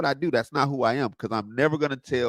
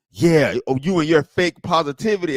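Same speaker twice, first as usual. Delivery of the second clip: mocking sneer; 4 semitones higher, 6 dB louder than usual. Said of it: "They were angry".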